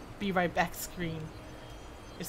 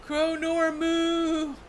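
high, loud, drawn-out name; hammed-up wailing proclamation on a high note; theatrical high call; 9 semitones higher, 6 dB louder, much slower than usual. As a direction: sing the name out high and slow. Repeating the name higher, theatrically.

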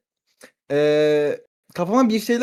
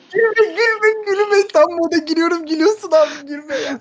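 laughing; 9 semitones higher, 3 dB louder than usual. Real, not acted. Scolding laughing friend, laughing.

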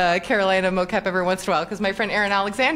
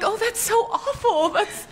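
much higher, hammed-up sympathy; mock dismay; 12 semitones higher, slower than usual. Actress teasing her gleeful writer, mock dismay. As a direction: pretend to be appalled, high and playful. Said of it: "I was like".

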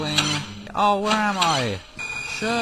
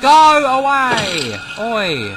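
high, loud, drawn-out yell; panicked shout; 7 semitones higher, 9 dB louder, much slower than usual. Real, not acted. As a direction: yell it long to drive it off. Shouting at an attacking monster.

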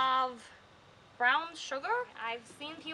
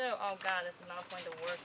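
usual speaking voice

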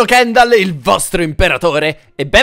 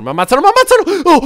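panicked; 11 semitones higher, 6 dB louder, faster than usual. Real, not acted. Being attacked.

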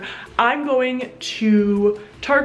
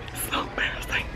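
hushed, mortified whisper; embarrassed whisper; 11 dB quieter, much faster than usual. Real, not acted. Vlogger whispering after being seen taking a selfie, embarrassed whisper.

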